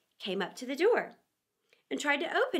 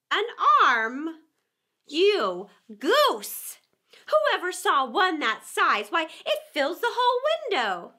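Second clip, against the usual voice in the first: high, louder scornful repeat; angry rabbit; 5 semitones higher, 7 dB louder, slower than usual. A narrator's reading. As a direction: throw his words back at him, annoyed. Rabbit scolds Pat.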